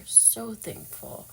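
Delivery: a choked, breathy hush of gratitude; real emotional whisper; tearful whisper. Real, not acted. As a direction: whisper it, too moved to voice it.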